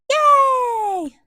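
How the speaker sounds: a high, loud, drawn-out squeal of joy; delighted sung-out yay; excited yay. Real, not acted. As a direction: cheer it way up high and long. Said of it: "cheering Nehemia's return".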